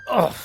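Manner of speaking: knocked back by a wall; pained grunt. Acted. Slamming into the invisible barrier, pained grunt. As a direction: grunt of impact.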